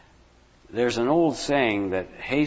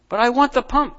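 high, loud whiny demand; crying out; 10 semitones higher, 4 dB louder than usual. Acted. Ian crying out to his father, high and insistent. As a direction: insist on it like a spoilt boy. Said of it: "cried Ian".

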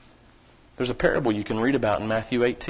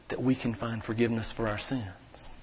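hushed whisper; 3 semitones lower, 10 dB quieter than usual. Real, not acted. Pastor whispers to the congregation about forgiveness. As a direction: drop to a whisper for the confession.

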